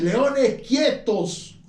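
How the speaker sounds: high, slow, stern command to the lions; preacher voicing Daniel commanding the lions. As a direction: order the lions to be still, slowly and from on high. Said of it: "dicho a los leones, ordenado a los leones".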